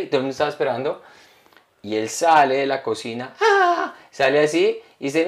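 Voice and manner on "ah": long, very high "ah"; imitated entrance cry. Guest imitates the comedian bursting from the kitchen, high cry. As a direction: let out a high, held mock cry.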